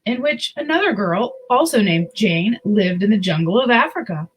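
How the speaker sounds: put-on reading a picture book to children; story narration. A narrator's reading. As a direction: tell it gently to little listeners.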